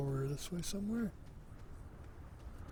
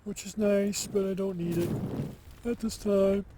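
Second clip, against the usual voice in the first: excited; 5 semitones higher, 6 dB louder than usual. Real, not acted. Continues the raised delivery.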